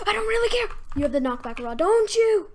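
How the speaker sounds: high, loud outburst at a rival; exasperated shouting. Real, not acted. Player confronting the knockback-rod opponent, high exasperated shout.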